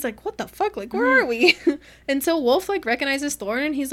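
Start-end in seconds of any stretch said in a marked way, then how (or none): 0.2-1.6 high, baffled mock outburst; retold bewilderment. Man who can't see asking around, high mock bewilderment. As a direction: play lost and baffled, pitch jumping up. Acts blind Thorn's confusion.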